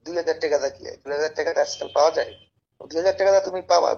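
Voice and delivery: usual speaking voice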